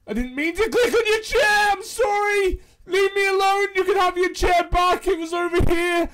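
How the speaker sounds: high, loud laughing-scared pleading; panicked apology. Real, not acted. Player chased by the ghost, pleading in laughing panic. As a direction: beg the ghost off, half laughing.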